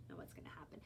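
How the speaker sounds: hushed whisper of suspense; suspenseful whisper. Real, not acted. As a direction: whisper the question to build suspense. Suspense before judging.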